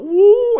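high, loud rocker uh; hammed-up rock-star wail; rock solo wail. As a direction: wail like a guitar hero. Mock air guitar solo cry.

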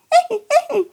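squeaky high giggle. Acted. Puppet laughs.

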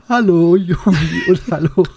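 laughing through the greeting; cracking up mid-line. Real, not acted. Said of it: "ich muss lachen".

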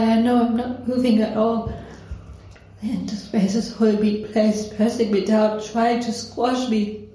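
ordinary talk

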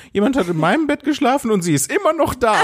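put-on high-pitched squeal of a baby bear; high baby bear cry. A narrator's reading. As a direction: call it out high like a cub. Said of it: "rief das Bärenbaby so laut".

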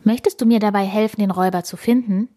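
quick fast question; fast friendly question; Tina asking. Acted. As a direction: rush the offer eagerly. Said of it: "fragt Tina".

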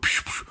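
hissed puffs of air, like bus doors; sliding-door noise. Retold or imitated. Storyteller mimicking van's sliding doors, airy psch sounds. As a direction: imitate doors sliding open.

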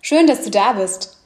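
polished scripted host intro, evenly paced; smooth radio-style welcome to listeners; podcast intro read. Acted. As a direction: read the show opener like a prepared announcement.

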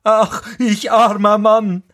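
high-pitched carter's wail; lamenting cry. A narrator's reading. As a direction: call out in self-pity, pitched high. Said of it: "rief er". (hoher Klageruf)

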